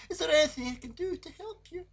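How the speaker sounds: put-on a tiny creature coaxing a crying girl; frog's voice. A narrator's reading. Frog voice continues.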